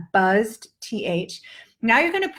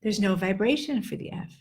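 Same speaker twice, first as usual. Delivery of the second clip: ordinary delivery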